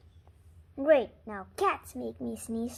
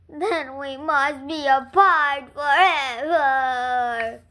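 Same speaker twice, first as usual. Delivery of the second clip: slow, louder hammed-up wail; mock despair; 9 dB louder, much slower than usual. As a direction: draw it out like a tragic farewell. Gerald sad to lose friend.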